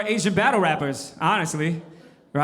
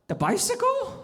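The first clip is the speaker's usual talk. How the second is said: high puzzled question, much higher; confused dad; 3 dB quieter than usual. Retold or imitated. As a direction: play the dad mishearing. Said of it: "He said".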